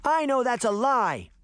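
high-pitched defiant protest; girl crying out. A narrator's reading. Daphne contradicting the wolf, crying out. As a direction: cry out in defiance. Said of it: "the little girl cried".

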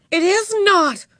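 high, cross contradiction; angry. A narrator's reading. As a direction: contradict angrily, voice jumping up. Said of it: "said Beezus angrily".

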